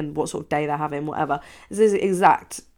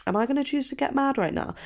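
mock inner voice; 8 semitones higher, 4 dB quieter than usual. Retelling her younger inner voice.

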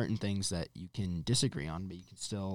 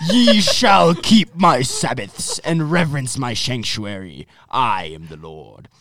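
put-on King James accent; 3 semitones higher, 12 dB louder, slower than usual. Reading Leviticus aloud to podcast friends, in a hammed-up King James accent. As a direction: boom out the verse in a mock King James accent. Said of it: "keep reading this verse in your, uh, King James accent".

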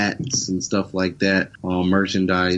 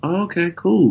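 higher-pitched friendly surprise, imitating locals; pleasantly surprised locals; 8 semitones higher, 3 dB louder than usual. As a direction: act out locals warming to a newcomer, pitch up.

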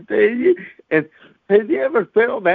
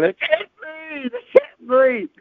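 much higher, rising to a shriek; mock screaming; 10 semitones higher than usual. Friend on the hospital floor screaming much higher in panic. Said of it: "go to screaming".

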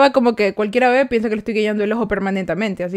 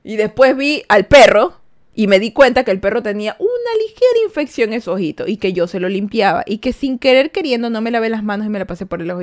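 ordinary talk

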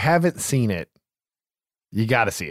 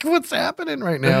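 bewildered; 14 semitones higher than usual. Retold or imitated. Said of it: "It's just like".

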